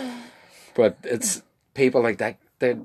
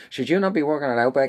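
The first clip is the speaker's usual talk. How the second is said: customer's question; 6 semitones higher, 4 dB louder than usual. Retold or imitated. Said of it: "they said to me".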